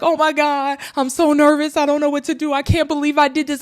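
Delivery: high-pitched, jittery retelling of nerves; retelling her pre-jump nerves, high and anxious; nervous panic. Retold or imitated. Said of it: "I was super nervous".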